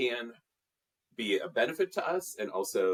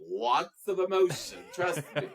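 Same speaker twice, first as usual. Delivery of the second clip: excited; 11 semitones higher, 3 dB louder than usual. Acted. Italian family emotion, voice jumps up.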